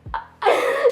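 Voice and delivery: laugh. Real, not acted. Laughing at her own fall.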